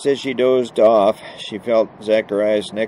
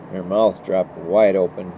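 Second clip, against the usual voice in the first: ordinary delivery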